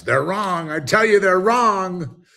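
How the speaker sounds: a stubborn man yelling as his house burns, high and loud; ranting deluded man. Retold or imitated. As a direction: ham up a shrill furious rant from a burning house. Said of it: "As you're burning in your house".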